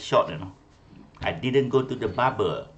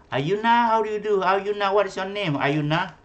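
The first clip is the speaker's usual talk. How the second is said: high, raised teacher's call; 8 semitones higher, 4 dB louder than usual. Real, not acted. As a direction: call out to a pupil who isn't answering. Calling an unresponsive pupil online.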